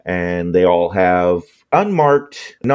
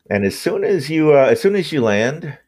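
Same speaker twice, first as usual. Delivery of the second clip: normal talking voice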